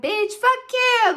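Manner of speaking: high, loud, drawn-out outburst; drunk best friend. Retold or imitated. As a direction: imitate a tipsy friend's indignant support. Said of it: "Like, she drunk".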